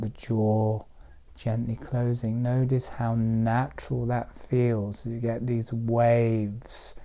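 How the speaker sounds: spacious, calming cues that slow toward the end; guided meditation. Real, not acted. Teacher guiding a group with eyes closed, meditative. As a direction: let each phrase float, then leave a pause.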